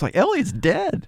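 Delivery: ordinary talk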